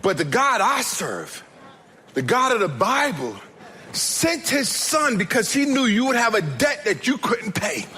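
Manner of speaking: high, forceful preacher's delivery; impassioned preaching. Acted. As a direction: proclaim it to the congregation, drawing out key phrases. Preacher rises into full declaration.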